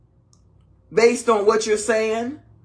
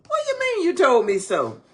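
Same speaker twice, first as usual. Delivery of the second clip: high, quick comeback; indignant retort; 8 semitones higher, faster than usual. Pretend. Spouse offended by an "I told you so", indignant. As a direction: fire it back, offended.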